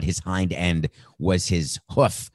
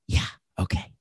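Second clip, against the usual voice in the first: whisper; 4 dB quieter than usual. Retold or imitated. Sly aside in acted call.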